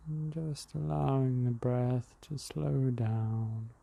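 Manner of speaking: calm breathing guide talking the listener through; guided meditation. Acted. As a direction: ease the listener into the breath, unhurried.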